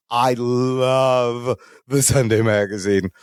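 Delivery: slow gushing with a drawn-out LOVE; low, lingering, over-the-top adoration. Retold or imitated. Nervous newcomer fawning over the magazine editor, gushing. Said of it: "He's gushing all over her".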